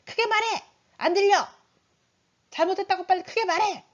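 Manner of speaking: high, loud parental order; harsh demanding. Acted. Parent forcing a child to confess out loud, harsh demanding.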